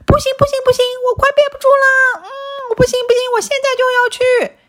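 put-on high, fast, whimpering child; desperate whining puppy. A narrator's reading. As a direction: plead urgently, near tears. Desperate to use the toilet.

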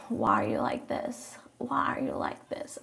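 whispered, disbelieving why are you like this; exasperated whisper. Real, not acted. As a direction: breathe it out in disbelief.

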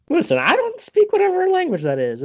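high, loud, laughing protest. Real, not acted. Admitting he can't pronounce the place name, laughing. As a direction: defend yourself through laughter.

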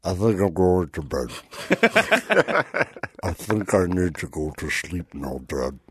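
put-on low, slow, drowsy kid voice; slow motion. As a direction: drag every word out, half asleep. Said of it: "he started talking in slow motion".

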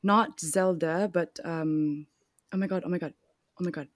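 put-on British accent. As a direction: play at sounding British. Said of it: "rest of the podcast in British".

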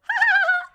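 loud high witch cackle. Acted. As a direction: cackle like an old hag. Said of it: "It's like a cackle".

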